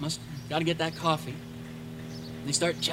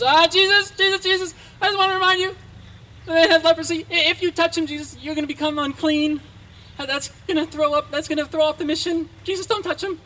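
anxious disciple; 8 semitones higher, 6 dB louder than usual. Acted. Disciples warning Jesus not to touch.

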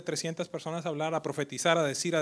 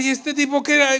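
higher, louder baffled question; puzzled churchgoer; 10 semitones higher, 11 dB louder than usual. Retold or imitated. Churchgoer wondering about the pastor, baffled. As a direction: sound baffled at the change.

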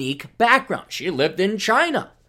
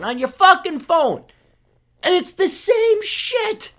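exasperated rant; 13 semitones higher, 4 dB louder, slower than usual. Real, not acted. Ranting about wasted cultural access.

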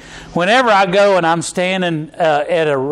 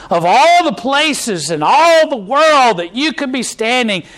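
preaching God's voice; 4 semitones higher than usual. Acted. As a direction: voice God's call with fervour. Voicing God's words, preaching.

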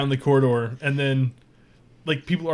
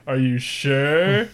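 slow, drawn-out, louder come-on; suggestive question; 5 dB louder, much slower than usual. Acted. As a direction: stretch it out knowingly. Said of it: "One of the providers is like".

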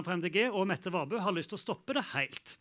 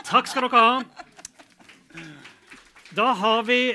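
loud, higher host voice; raised moderator's voice; calling over applause; 3 semitones higher, 9 dB louder than usual. Real, not acted. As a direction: project thanks to the whole room. Host calls over applause after debate.

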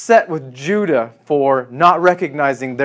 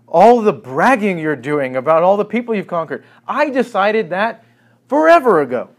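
scornful retort; 7 semitones higher, 5 dB louder than usual. Acted. God answers boasting.

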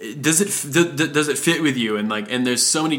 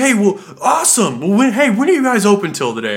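higher, louder eager questions with a laugh; excited customer; 8 semitones higher, 4 dB louder than usual. Retold or imitated. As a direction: bubble over with eagerness.

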